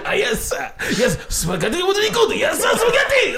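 very high mock Japanese announcer, laughing; Japanese game show host. Annoying the family all week, Japanese game show host voice. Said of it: "was like that".

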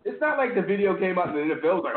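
ordinary talk

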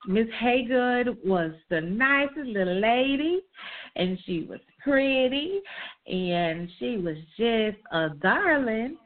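slow Southern drawl on the teacher's praise; Southern accent. Retold or imitated. Describing her sweet second-grade teacher in a slow Southern accent. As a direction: lean into a gentle Southern drawl. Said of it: "What is this Southern accent?".

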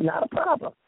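high light quote. Retold or imitated. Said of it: "He said".

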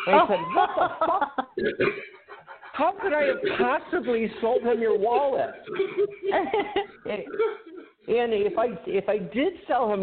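high, breaking into laughter through the words; can't hold it together, laughing out each word; laughing hard. Real, not acted. Telling friends the wallet story, laughing hard. Retelling funniest part, cracking up.

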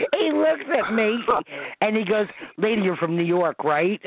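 high, giggly retelling; can barely get the words out for laughing. Real, not acted. Retelling the guy's reply, laughing.

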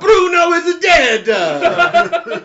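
loud hammed-up crying wail; mock wail. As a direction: bawl it like a cartoon mourner. Mock wailing over Bruno.

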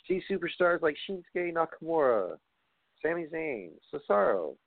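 high, brisk sales pitch voice; automated ad read. Acted. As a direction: rattle it off like a canned commercial. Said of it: "I sound like an automated fucking response".

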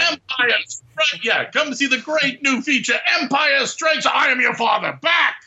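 high, shouted trailer hype; shouting trailer announcer. Pretend. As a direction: yell it like a carnival barker. Mock movie trailer shouting.

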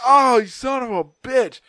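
loud, high cursing in retold pain; pained outburst. Patient cursing the doctor jabbing his gut, pained outburst. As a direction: curse out loud as the jab lands.

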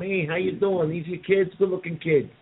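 a casino wiseguy working the room; mobster type. Retold or imitated. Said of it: "the Joe Pesci type going".

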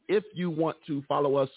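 ordinary talk, nothing marked

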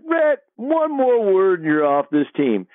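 high, slow warning from an irate coach; Coach Sutton. Retold or imitated. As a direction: give a drawn-out mock ultimatum, pitched high. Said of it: "coach is hot".

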